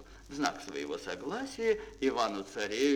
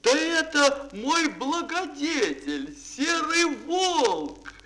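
joyful cry; 12 semitones higher, 5 dB louder than usual. A narrator's reading. Prince sees his benefactor the grey wolf, joyful shout. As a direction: shout it out in glad recognition. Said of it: "Иван-царевич закричал".

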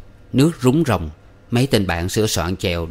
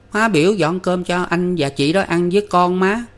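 put-on small child voice; 10 semitones higher than usual. A narrator's reading. Little son pleads with mother.

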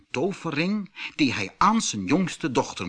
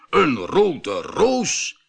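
slow, higher delighted exclamation; joyful surprised cry; 4 semitones higher, 4 dB louder, much slower than usual. A narrator's reading. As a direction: savor the surprise of the rose. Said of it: "riep de koopman blij".

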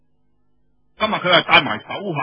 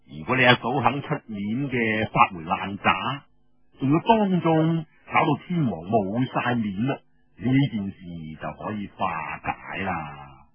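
scheming man; 6 semitones lower than usual. Acted. Feng explains plan.

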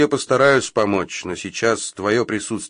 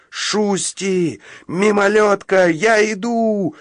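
high, loud cry for his friends; desperate howl; 11 semitones higher, 4 dB louder, slower than usual. Acted. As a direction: howl out, stretching the last words slowly. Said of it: "взвыл Фритти".

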